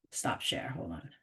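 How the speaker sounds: very soft, half-voiced mutter; hushed aside to yourself; muttering to oneself. Real, not acted. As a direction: talk under your breath while fiddling with the controls. Muttering to self while fixing screen.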